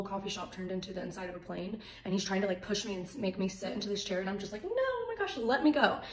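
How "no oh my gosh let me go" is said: very high, drawn "no", then louder plea; high-pitched mock cry to be released; acted protest. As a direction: re-enact wriggling free from someone. Retold struggle to get away.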